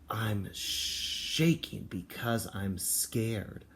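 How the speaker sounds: slow, hushed, trembly read; hammed-up fright for kindergartners; mock scared. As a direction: shrink into the words like a frightened child. Acting scared while reading aloud.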